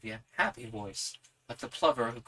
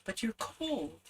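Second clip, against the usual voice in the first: put-on girl's voice; 10 semitones higher, slower than usual. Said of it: "in her clear, quiet voice".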